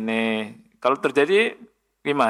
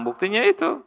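emphatic retort; 11 semitones higher than usual. Retold or imitated. Retold retort to the driver.

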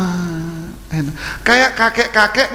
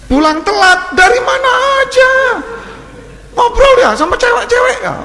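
higher, louder accusing wife, speeding up; hammed-up jealous wife scolding; a wife grilling her late husband about other women; mock nagging wife; 13 semitones higher, 3 dB louder than usual.

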